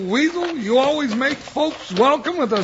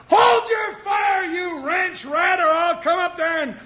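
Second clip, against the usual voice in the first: angry yell; 5 semitones higher than usual. Acted. Deputy yells at the old rancher shooting at them, angry. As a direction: yell back at the rancher who fired. Shot at, yelling back.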